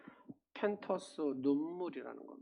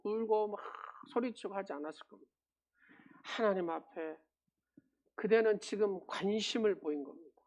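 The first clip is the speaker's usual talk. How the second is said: normal talking voice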